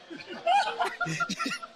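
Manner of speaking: very high mocking cackle; high squealing laugh. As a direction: shriek a taunting laugh at a kid. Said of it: "and he goes".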